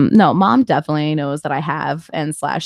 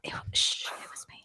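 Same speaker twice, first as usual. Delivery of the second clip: whisper; 15 dB quieter than usual. Real, not acted. Hushing mid-argument.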